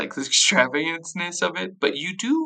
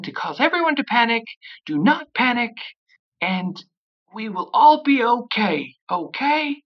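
high, raised voice of a nervous headteacher; loudspeaker announcement; 8 semitones higher than usual. Acted. Alora calming the whole school over the loudspeaker, high and strained. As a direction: announce over the speaker, trying to keep calm. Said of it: "does an announcement".

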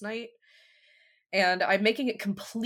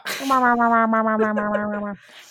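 long loud laugh; loud cackling laugh; 4 semitones higher, 11 dB louder than usual. Real, not acted. Laughing at Muppet face.

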